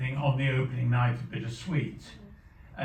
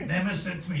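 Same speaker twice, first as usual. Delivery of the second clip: ordinary talk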